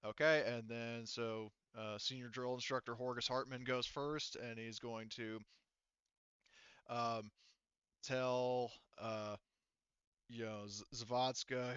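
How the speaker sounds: usual speaking voice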